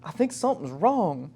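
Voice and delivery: high-pitched imitated gas company lady; Pam. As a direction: mimic a puzzled caller explaining a problem. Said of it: "the lady's like".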